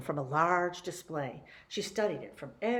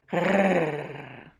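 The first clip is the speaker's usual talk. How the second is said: dog growl; 2 semitones lower, 3 dB louder than usual. Acted. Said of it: "said Martha".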